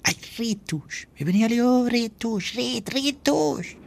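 high, whining despair at the screw-up; exasperated groan. Acted. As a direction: moan that it's all ruined. Ayoub despairing over the blunder.

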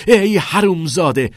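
loud, high enraged shout; attacking yell; furious curse. A narrator's reading. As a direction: explode with rage. Springs up and attacks him.

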